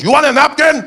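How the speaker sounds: high, loud order call; taco vendor. Acted. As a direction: sing out the order. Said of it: "So he goes".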